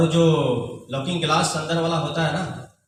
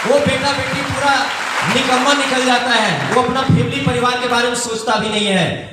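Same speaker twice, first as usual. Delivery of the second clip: raised, louder accusing voice; indignant outburst; 7 semitones higher, 4 dB louder than usual. Real, not acted. Speaker scolds children who forget parents, loud and indignant.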